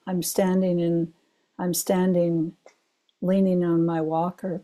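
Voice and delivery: ordinary talk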